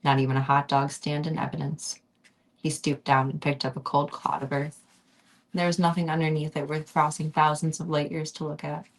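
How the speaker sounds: ordinary delivery, nothing marked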